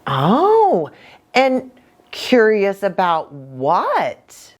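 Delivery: usual speaking voice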